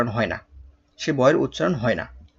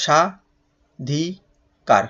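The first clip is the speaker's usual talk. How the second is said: স্বাধিকার very slowly in three separate parts; split into syllables; much slower than usual. Shown as a demonstration. Teacher breaking the word up for pupils, স্বাধিকার syllable by syllable. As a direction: pause after each part of স্বাধিকার: sha, dhi, kar. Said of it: "অক্ষর বিন্যাস করে ফেলো".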